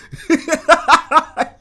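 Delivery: high loud burst of laughter. Real, not acted. Laughing at his own Burger King joke, loud laugh. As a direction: crack up.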